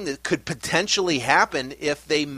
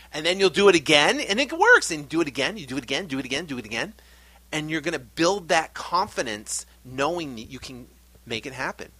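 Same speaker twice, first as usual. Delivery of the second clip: ordinary delivery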